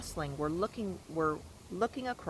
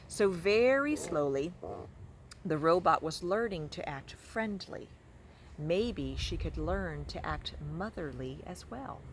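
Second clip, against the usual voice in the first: ordinary delivery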